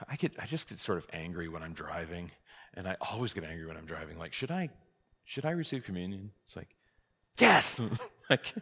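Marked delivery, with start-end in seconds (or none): 0.1-6.3 lightly voiced sheepish questioner, softer; hesitant parishioner. Worried churchgoer asking the priest about communion, lightly voiced and hesitant. 7.4-7.8 high, loud comic exclamation; emphatic answer. Acted. Priest answering a doubting parishioner, comically emphatic.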